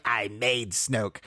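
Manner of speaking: slow, soft sinister line; a hint of the sinister emperor; Emperor impression. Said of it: "then when he says".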